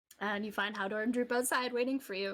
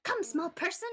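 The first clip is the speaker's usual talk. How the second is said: goblin voice; 8 semitones higher, 3 dB louder than usual. Acted. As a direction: croon it like a delighted goblin. Goblin beckons the boy.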